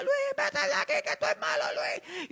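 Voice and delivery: witchy shriek with a laugh in it; shrieking old woman. Retold or imitated. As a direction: screech his name like a ghost hag. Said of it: "ella me gritaba".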